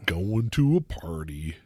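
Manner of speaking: low, slow gloomy donkey voice; Eeyore. Acted. Host teasing co-host as Eeyore, low slow gloom. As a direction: drag it out low and glum. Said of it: "Ian is Eeyore".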